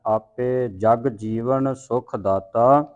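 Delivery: ਜਗ ਜੀਵਨ said heavily, very slowly; heavy pronunciation. Shown as a demonstration. Teacher showing heavy pronunciation, slow weighted ਜਗ ਜੀਵਨ. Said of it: "ਇਸ ਨੂੰ ਭਾਰਾ ਉਚਾਰਨ ਕਰਨਾ ਹੈ".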